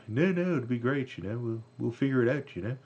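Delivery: bad impression, pitched well up; coaxing, high-pitched impersonation, badly faked. Bandleader talks the drummer out of quitting, bad high-voiced impression. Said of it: "that's a really bad [name] impression".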